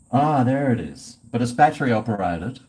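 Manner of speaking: usual speaking voice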